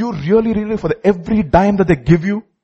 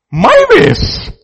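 MY WAYS thrown out high and loud; emphatic answer; 13 semitones higher, 5 dB louder than usual. Real, not acted. Preacher answering his own question for the congregation, emphatic. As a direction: hit MY WAYS hard, pitched way up.

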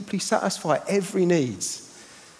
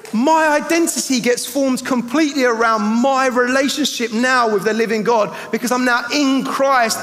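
loud, high, fervent declaration; preaching at full force; 5 semitones higher, 4 dB louder than usual. Acted. Preacher declaring his faith to the church, loud and fervent. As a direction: proclaim it with rising joy.

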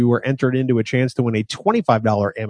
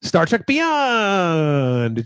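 higher, very drawn-out announcement; mock dramatic reveal; 4 semitones higher, much slower than usual. Host revealing the upcoming film to co-host, drawn-out mock announcer reveal. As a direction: stretch Beyond out long after a teasing pause. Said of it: "wait for it".

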